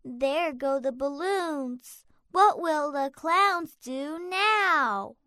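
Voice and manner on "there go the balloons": usual speaking voice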